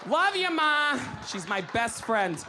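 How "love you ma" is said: higher, louder call to mom; shout-out to mom. Real, not acted. Comic calling out to her mother in the audience. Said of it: "Let's give it up for Linda".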